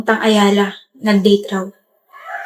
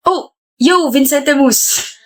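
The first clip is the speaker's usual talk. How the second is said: ordinary talk, nothing marked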